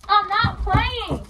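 high, slow, drawn-out warning; heated, drawn-out protest; angry insistence. Acted. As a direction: stretch it out to show you mean it.

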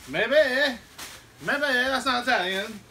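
high, loud mock Italian; fake Italian accent. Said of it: "You trying to be Italian?".

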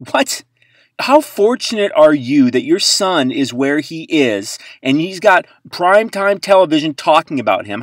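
loud, high exasperated telling-off; mock rant. Host telling off Mahomes' mom, loud exasperated rant. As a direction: scold her like she's right there. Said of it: "And I was like".

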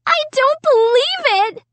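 very high, outraged shriek. A narrator's reading. Sister seeing paint on her carpet, shrieking. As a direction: shriek it in disbelief. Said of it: "Sarah kept shrieking".

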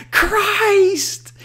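very high, loud yell. Acted. Friend on a power slide in the monks' room, yelling. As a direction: belt it out like a rock star. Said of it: "and yells".

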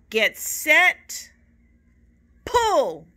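loud, high count-off to pull; rally the class to heave; a loud go signal for the pull; calling out the count. Acted.

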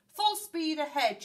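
loud, high captain's command; called-out order. A narrator's reading. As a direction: call the order out to the crew. Captain ordering his crew.

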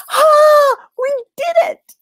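high, loud shout of victory; triumphant cheer. Real, not acted. Storyteller finishing the hardest twister, triumphant shout. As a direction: whoop it out in triumph.

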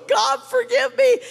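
high, loud mock-tearful cry; imitated weeping plea. Weeping husband falls beside his wife, imitated anguished plea. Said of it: "he's weeping and he's going".

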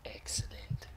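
breathy whispered satisfaction. Real, not acted. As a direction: whisper it to yourself. Quiet aside during game.

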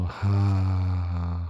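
long releasing exhale; slow open-mouthed sigh. Shown as a demonstration. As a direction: sigh it out and let it go. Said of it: "sigh it out, let it go".

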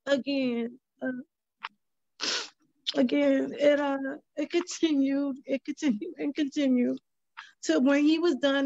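real tearful voice, pitched high; choked up. Real, not acted. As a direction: push on through rising tears, halting. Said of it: "It kinda touches me".

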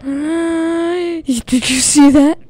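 slow, breathless awed question; gasping fright. Real, not acted. Player spots a leviathan, asks chat in breathless awe. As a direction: gasp and ask in disbelief.

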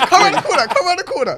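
high, loud call through laughter; laughing taunt. Retold or imitated. Man retells daring rivals round the corner, laughing high taunt. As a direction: shout the invitation, pitched right up and amused.

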